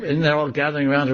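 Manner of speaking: ordinary talk, nothing marked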